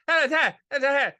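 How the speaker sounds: high, loud repeated hey from the back of the voice; Uncle TW impression. Uncle calling about the matured money, high loud heys. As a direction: call out hey like the mumbling uncle. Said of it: "my Uncle T W used to talk like that".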